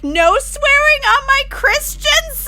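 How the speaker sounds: high, loud imitation of an indignant child; upset little kid. Streamer imitating a kid scolding older teammates, upset. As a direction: squeal it like an outraged small kid. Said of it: "the kid's like".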